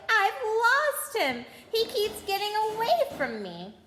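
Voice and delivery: high, slow, tearful wail. Acted. Boy who lost his toad wailing to Harry. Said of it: "he wailed".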